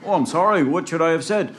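high, pleading question; frantic apology. Acted. Jack asking the man what to say, high pleading.

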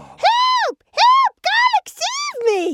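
very high, loud screaming sheep; cries for help. Acted. Sheep screams for her husband, cries for help. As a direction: scream for rescue at the top of the voice. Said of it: "Garlic heard the cries".

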